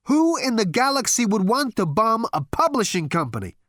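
high, loud disbelieving question; incredulous outcry. Acted. Zaphod as bombs hit the publishing company, incredulous outcry.

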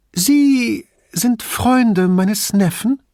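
put-on high, slow, frail old lady; old woman voice. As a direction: ask like a fragile elderly aunt. Narrator voices old signora.

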